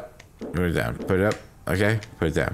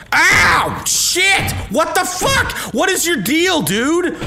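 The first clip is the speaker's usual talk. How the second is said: high, loud startled yells; sudden outraged yelling; pained yelling; 5 dB louder, much slower than usual. Real, not acted. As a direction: shout it out in shock and pain. Attacked in game, yelling.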